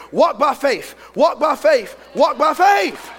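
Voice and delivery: usual speaking voice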